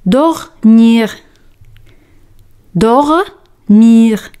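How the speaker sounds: "dormir" said clearly with French uvular r; teacher models "dormir"; model pronunciation. Shown as a demonstration. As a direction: drill "dormir" slowly and clearly. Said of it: "El verbo dormir".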